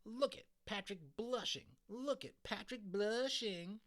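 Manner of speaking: high, slow, squawking singsong taunt. A narrator's reading. April taunting Patrick for blushing, high and squawking. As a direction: squawk it like a mocking crow. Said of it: "she squawked".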